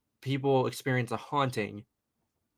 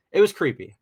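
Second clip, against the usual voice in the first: creepy punched much higher and louder; emphatic creepy; 7 semitones higher, 11 dB louder than usual. Real, not acted. Boy recalling the painting with emphatic stress. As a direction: lean hard on it was creepy.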